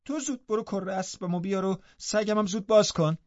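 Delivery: high-pitched, cross command; furious young mistress giving orders; angry. A narrator's reading. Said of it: "با عصبانیت به او گفت".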